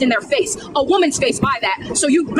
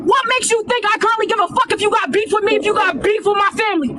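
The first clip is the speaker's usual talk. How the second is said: furious yelling; 5 semitones higher than usual. Real, not acted. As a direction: explode at him with all you've got. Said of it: "I wanna apologize for yelling on this live".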